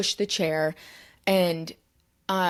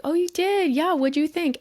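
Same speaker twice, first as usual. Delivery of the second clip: high, louder imitation of an eager reply; delighted interest; 11 semitones higher, 4 dB louder than usual. Actor replies to a fan who saw his show, delighted. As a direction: pitch it up with eager curiosity.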